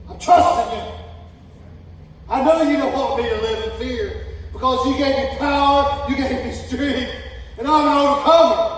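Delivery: loud, high declaration of faith; preaching loud. Real, not acted. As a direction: shout the confession.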